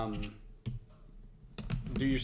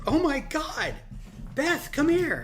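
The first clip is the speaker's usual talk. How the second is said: high, loud excited shout to Beth; excited call; 6 dB louder, slower than usual. Retold or imitated. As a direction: call her over in a rush of excitement. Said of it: "and I said".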